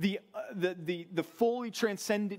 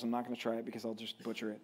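ordinary delivery

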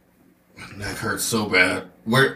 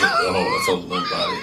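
excited squeal. Real, not acted. Players react to limp character.

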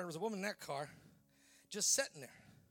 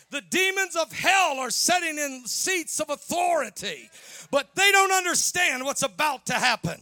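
shouted, ringing sermon climax, rising higher and louder; full-force preaching; 6 semitones higher, 14 dB louder than usual. Acted. Preacher rallying the church to come out of the cave, thundering. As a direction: preach it out loud to the congregation, building to a roar.

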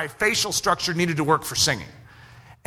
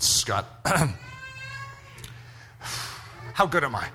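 low, hesitant ask; a hint of a nervous student; timid question; 4 semitones lower, 5 dB quieter than usual. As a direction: work up the nerve. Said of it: "I bounced on my toes".

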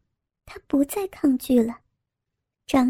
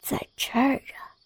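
put-on teasing young scholar; 7 dB quieter than usual. A narrator's reading. Zhang Sheng whispers.

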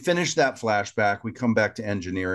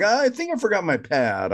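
Chakotay; 11 semitones higher than usual. Retold or imitated. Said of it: "Chakotay's like".

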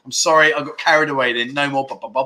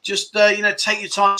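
calming; 6 semitones higher than usual. Retold or imitated. Soothing a pushy drinking friend, calming. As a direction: settle an eager friend down gently.

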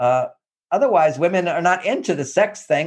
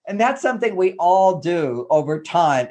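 normal talking voice

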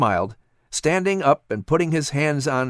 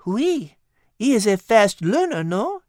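put-on high, lilting French-accented cat voice; a wise cat coaxing gently; cat Liz; 9 semitones higher than usual. Cat Liz coaxing Patrick in a high French-tinged voice.

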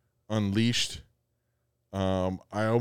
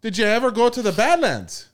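high, loud Upper Midwest lilt; North Dakota accent; 11 semitones higher, 6 dB louder than usual. Pretend. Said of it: "North Dakota accent".

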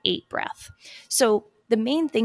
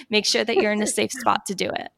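usual speaking voice

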